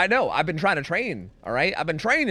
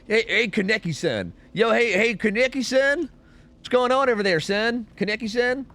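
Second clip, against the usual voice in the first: higher, mock hailing someone across the way; pretend calling out; 7 semitones higher than usual. Streamer acting how he would call Kaneki-san, playful rising hail. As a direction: call out playfully, climbing in pitch. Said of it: "if this was me, I'd be".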